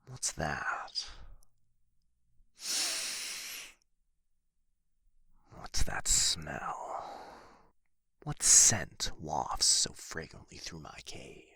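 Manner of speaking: hushed, suspicious whisper; a dragon whispering as it sniffs out an intruder. Acted. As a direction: breathe the words as you sniff the air. Dragon senses intruder in cave.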